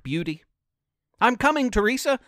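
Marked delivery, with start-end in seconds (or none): 1.2-2.2 higher, calling out to a friend far off; yelled. Acted. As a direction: yell it ahead as you run. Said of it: "Donnie yelled".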